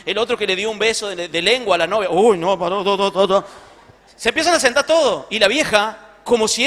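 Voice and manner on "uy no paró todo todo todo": guilty churchgoer. Acted.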